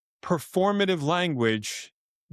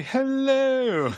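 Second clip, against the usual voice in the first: a much higher, playful "Hello."; pitched-up demo greeting to Kaylee; high bright hello; 10 semitones higher than usual. Shown as a demonstration. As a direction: sing the "Hello." up high, teasing. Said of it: "Just say".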